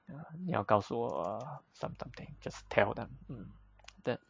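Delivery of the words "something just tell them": ordinary talk, nothing marked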